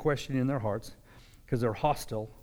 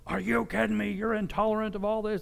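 higher, indignant accusation; exasperated mock retort, pitched up; mock outrage; 7 semitones higher than usual. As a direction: play the offended person crying intolerance.

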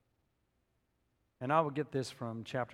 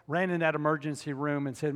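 normal talking voice

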